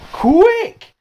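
high, loud urgent cry; shout. A narrator's reading. Pete shouts to the class to shut the window. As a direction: yell it to the class in alarm. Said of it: "shouted Pete".

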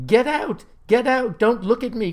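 high, loud mortified cries; crying out. A narrator's reading. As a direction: cry out to drive them away. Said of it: "cries the boy".

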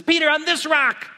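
high, loud fired-up declaration; Jesus fired up, telling Peter on this rock. Acted. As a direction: proclaim it like Jesus all fired up. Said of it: "he may have got really fired up".

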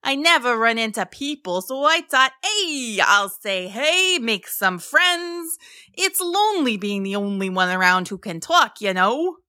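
friendly, chatty mosasaur voice. A narrator's reading. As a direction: play a sociable, lonely sea monster. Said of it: "Mo responded".

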